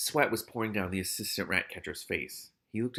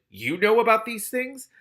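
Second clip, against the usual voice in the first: high, louder rising question; amazed; 11 semitones higher, 7 dB louder than usual. A narrator's reading. Keith turning to Malicia over rat kings, amazed. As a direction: ask it in open amazement. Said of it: "turned to her in amazement".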